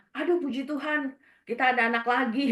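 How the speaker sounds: imitated delighted husband. Husband hears of the pregnancy, high, loud retold delight. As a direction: imitate a husband overjoyed at the news. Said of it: "suami ya seneng banget kan".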